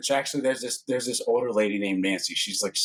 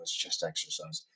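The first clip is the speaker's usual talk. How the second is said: unvoiced, soft reassurance; breathy throwaway; 6 semitones lower, 9 dB quieter than usual. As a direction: whisper it like a joke aside. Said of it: "I go".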